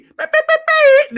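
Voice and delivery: very high sung beeps like a bleep tone; mock censor beep. Streamer bleeping out a name, high mock censor beep.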